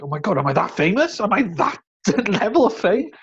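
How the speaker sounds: astonished, pitch climbing high; retold wide-eyed astonishment; amazed disbelief. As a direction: marvel at it, half laughing. Retells own amazement at being recognised.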